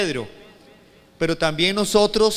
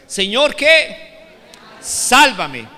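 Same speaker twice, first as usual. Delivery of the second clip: loud, raised call-and-response prompt; rally the congregation to say it louder; llamada a repetir con fuerza; 5 semitones higher, 8 dB louder, much slower than usual. Shown as a demonstration. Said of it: "Otra vez". (consigna de predicador en voz alta)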